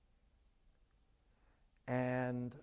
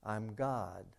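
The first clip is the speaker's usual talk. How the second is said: low, softer, unhurried divine voice; calm voice of God; 4 semitones lower, 8 dB quieter than usual. Acted. God tells the man to let go, low and calm. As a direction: speak down from heaven, serene and lower.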